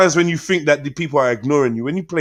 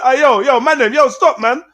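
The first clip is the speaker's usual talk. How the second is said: rising shout; 11 semitones higher than usual. Retold or imitated. Retold trying to quiet everyone.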